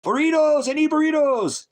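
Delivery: high, loud shout for burritos; loud demanding yell. Real, not acted. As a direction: belt it out, demanding food. Yelling for burritos to someone.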